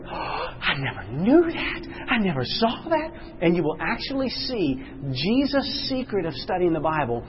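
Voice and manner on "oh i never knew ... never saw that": a hint of someone just seeing it; amazed discovery. Bible student discovering prophecy, lightly amazed. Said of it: "Some people say".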